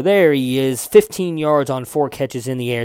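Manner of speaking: ordinary talk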